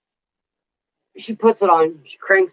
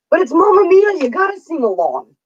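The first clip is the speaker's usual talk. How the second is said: pleading protest; 11 semitones higher than usual. Retold or imitated. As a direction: defend yourself in a high, insistent voice. Acts out defending herself for singing.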